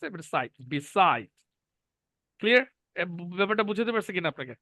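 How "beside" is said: beside with a strong, falling SIDE; short be, then land on SIDE in beside; syllable stress. Shown as a demonstration. Teacher repeating the example, beside stressed on SIDE. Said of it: "অর্থাৎ বি, এটা stress".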